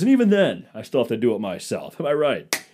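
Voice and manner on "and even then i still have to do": loud, higher hammed-up stand-up voice; hack comedian. Comic parodies a cheesy club comic, loud and hammy. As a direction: play the cheesy club comic working the room.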